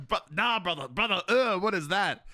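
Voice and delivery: high, loud mock disgust; hammed-up high-pitched revulsion; meme impression. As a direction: play up the appalled "brother, ugh" meme. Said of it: "that was my favorite thing".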